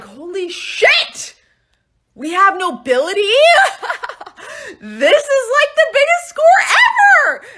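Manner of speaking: high, loud, laughing whoop; excited shout. Retold or imitated. Pirates finding Caesar aboard, laughing and shouting. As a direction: whoop over a huge prize.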